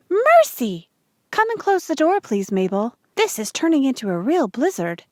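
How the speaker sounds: high, loud startled exclamation; startled cry. Acted. Ma calling to Mabel as the wind slams the door, startled. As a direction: yelp as the wind tears the door away. Door ripped away by storm.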